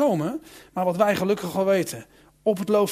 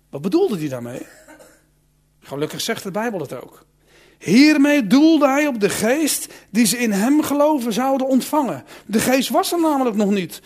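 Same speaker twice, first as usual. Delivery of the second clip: normal talking voice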